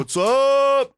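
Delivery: high, loud, stretched-out hype shout; brash action star. Acted. Mocking typical action stars to a co-host, brash holler. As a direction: holler it like a hammed-up action hero. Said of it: "not like your normal, uh, triple A action star".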